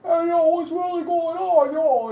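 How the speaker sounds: high, loud mock Italian accent; hammed-up fake Italian accent. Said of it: "I'm gonna fake an Italian accent too".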